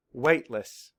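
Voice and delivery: ordinary delivery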